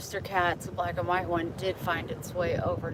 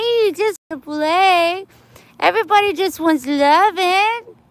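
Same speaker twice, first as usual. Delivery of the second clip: much higher and louder pet voice; 8 semitones higher, 11 dB louder than usual. Real, not acted. Cooing loudly to a neutered cat. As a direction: gush over the cat in a squeaky voice.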